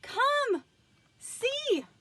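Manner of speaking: high, loud excited call. A narrator's reading. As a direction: urge her over, thrilled. Mr Watson calls wife to door.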